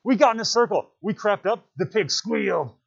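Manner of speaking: high, loud eager boasting; excited hunters. Acted. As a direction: hunters chime in, thrilled. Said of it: "Voices broke in from the hunters".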